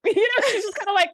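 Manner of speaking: talking through a high laugh; laughing as the words come. Real, not acted. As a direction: giggle the words out. Laughing while recapping scene.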